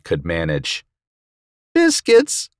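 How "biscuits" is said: very high, pained little whimper. A narrator's reading. Bitten man trying his safe word, whimpering. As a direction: squeak it out as a whimper. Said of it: "I whimpered".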